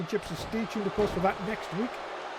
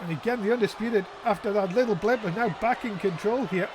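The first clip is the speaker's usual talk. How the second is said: wrestling commentator; 2 semitones higher than usual. Real, not acted. Live play-by-play commentary.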